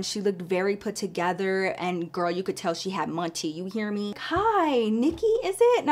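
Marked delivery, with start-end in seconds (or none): 4.3-5.8 elegant lady. Retold or imitated. Elegant stranger greeting narrator.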